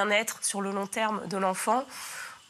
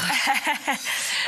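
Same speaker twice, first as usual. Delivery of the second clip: burst of laughter; 6 semitones higher, 5 dB louder than usual. Real, not acted. Laugh after child joke.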